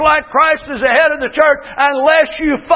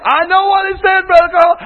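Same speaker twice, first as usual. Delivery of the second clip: high, insistent mimicked retort; exasperated wife; 5 semitones higher than usual. Wife answering her pastor's question, exasperated and insistent. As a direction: push back impatiently as the wife. Wife argues back again.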